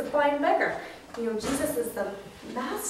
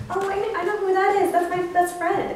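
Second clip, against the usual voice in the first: high, louder, delighted recognition; excited recognition; 8 semitones higher, 6 dB louder than usual. Acted. Child spotting a friend down the road, excited. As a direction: spot your friend and exclaim it.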